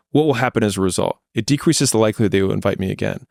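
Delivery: usual speaking voice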